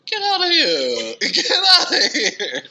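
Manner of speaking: very high, loud, slow squeal through a laugh; delighted disbelief. Retold or imitated. Winner reliving the news email, high laughing squeal.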